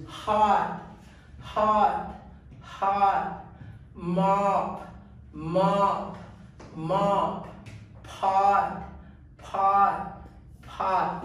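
"hot", "mop", "pot" with an open ah vowel; short O words. Shown as a demonstration. Teacher drilling short O words. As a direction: drill each open ah word. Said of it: "the A words".

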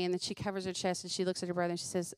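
ordinary delivery

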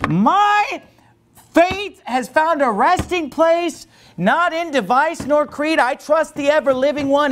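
high, ringing hymn recitation; impassioned preaching. Real, not acted. Preacher recites a hymn against emotionalism, impassioned high declamation. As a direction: declaim the verse with building force.

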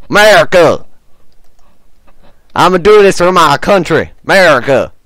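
loud, proud cry of America; rousing patriotic shout; patriotic cheer. Acted. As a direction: belt it out for the country.

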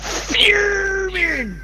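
high strained yell of outrage; high mock-furious yell; fuming shout. Real, not acted. Shouted reaction.

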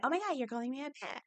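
high, hushed, quick mock outrage; rushed, high little voice of offence; mock offended wife. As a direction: imitate her affronted reaction, small and high. Retold wife taking offence.

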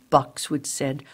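ordinary delivery

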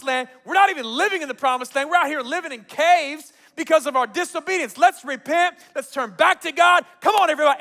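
high, loud exhortation; rallying preaching. Retold or imitated. Gideon calling his people back to God, preaching hard. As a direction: preach at full force to rouse the people. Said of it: "Gideon steps up and preaches a little bit".

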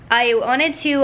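normal talking voice

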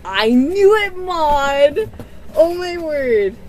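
loud, high cry of delight; thrilled. Real, not acted. Calling to the friend who sent the gift, loud with delight. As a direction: burst out at the friend in joy.

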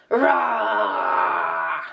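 low growling roar; short throaty dinosaur roar. Acted. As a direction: roar like a big tyrannosaur. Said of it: "tylko ryczy".

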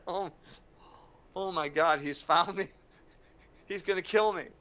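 high-pitched comic alarm; mock panic. Speaker seeing huge Rick running at him, hammed-up fright. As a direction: panic playfully, voice jumping up.

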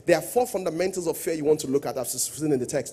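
ordinary talk, nothing marked

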